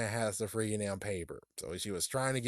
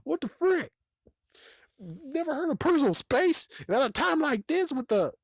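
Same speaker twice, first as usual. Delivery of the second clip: high, fast, louder exasperated rant; 3 dB louder than usual. Real, not acted. Ranting at the ghost who must touch first, exasperated. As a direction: go up and loud, fed up.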